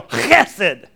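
chesed with a loud throat-clearing ch; throaty Hebrew word. Shown as a demonstration. Preacher teaching the crowd to say chesed, hawked and loud. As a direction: hawk up the ch of chesed from the back of the throat. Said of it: "the word is chesed".

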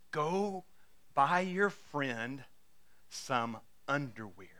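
soft, slow nudge with long pauses; quiet divine prompting. Retold or imitated. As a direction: lay it down low and slowly, piece by piece. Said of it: "this whispering".

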